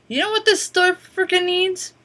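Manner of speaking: lightly voiced feisty old woman, asking bluntly; Martha's blunt question. Old customer sizing up the store, feisty and loud.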